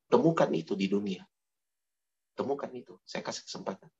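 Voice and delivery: soft, low and tender; quiet tender correction; gentle divine voice. Acted. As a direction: speak like a merciful God. God answers gently in story.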